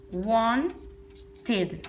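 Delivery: slow, split wanted: "wan... tid"; wanted in two clearly separated syllables; ID ending. Shown as a demonstration. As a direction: pause before the "tid" in wanted. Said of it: "Con una I D".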